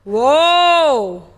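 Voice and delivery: loud drawn-out whoa; amazed whoa. Real, not acted. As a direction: react like you can't believe it. Amazed at breath hold.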